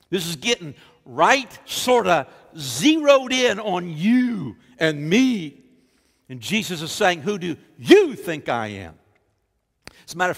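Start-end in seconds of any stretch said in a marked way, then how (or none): 0.1-5.6 loud, raised, drawn-out emphasis; emphatic preaching. Real, not acted. Preacher making it personal to you and me, loud and emphatic. 7.2-9.0 hard stress on "you"; emphatic question. Retold or imitated. Jesus asking each person directly, emphatic stress on "you". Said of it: "is emphatic".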